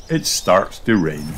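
usual speaking voice

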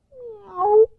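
a bright grateful mew; kitty meow. Acted. A kitten thanking the lady for the milk. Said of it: "the little kitty says".

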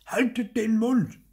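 loud high shout back. A narrator's reading. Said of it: "schrie Gauber zurück".

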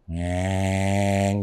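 long, low held keyboard hum; imitated keyboard drone. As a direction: mimic a synth note droning on. (tiruan dengung keyboard yang panjang)

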